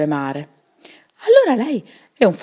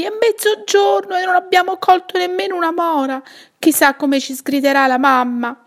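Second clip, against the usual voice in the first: high, loud panicked cry; boy crying out in alarm; alarmed shout; 10 semitones higher, 4 dB louder than usual. Acted. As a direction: yell as if stung by a wasp. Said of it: "Paolo gridò".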